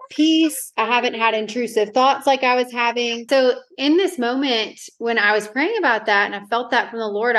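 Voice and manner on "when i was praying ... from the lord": normal talking voice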